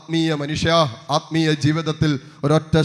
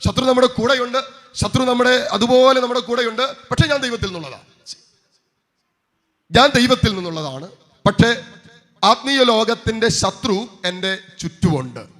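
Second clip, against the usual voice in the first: raised, emphatic pulpit voice; forceful preaching; 7 semitones higher, 5 dB louder than usual. Real, not acted. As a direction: preach it out with conviction. Preacher declaiming to congregation.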